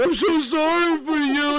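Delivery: loud, high-pitched hammed-up wail; mock crying. Listener apologizing over his dead family, in a mock cry. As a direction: exaggerate a tearful, wailing apology. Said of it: "Then you're crying".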